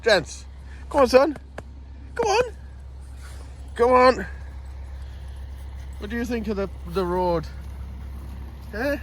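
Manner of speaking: high, sing-song voice for a dog; high-pitched dog talk. Real, not acted. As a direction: coax the dog in a squeaky voice. Talking to his dog.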